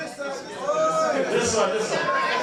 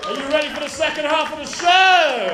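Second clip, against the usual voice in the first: hype shout; 3 semitones higher, 4 dB louder than usual. Real, not acted. Host rousing the audience for the second half, hype shout. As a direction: belt it out to rouse the crowd.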